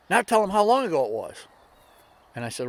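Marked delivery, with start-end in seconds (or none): 0.1-1.5 high, loud prompting from the friend; prodding older lady. Retold or imitated. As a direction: prod her in a high, insistent voice. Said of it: "the other lady says".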